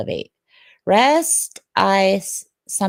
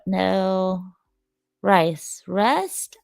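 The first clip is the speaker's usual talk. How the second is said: ordinary talk, nothing marked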